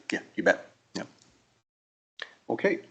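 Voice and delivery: ordinary delivery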